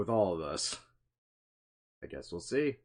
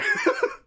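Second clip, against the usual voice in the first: high cackle; 5 dB louder than usual. Acted. Vampire laugh after joke.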